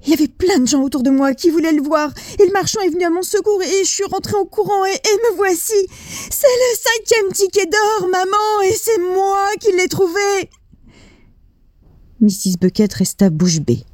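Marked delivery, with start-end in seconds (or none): 0.0-10.5 put-on high, quick, breathless child's voice; a boy rushing out his big news, high and fast; excited young Charlie. Charlie tells his mother about the ticket, high and fast.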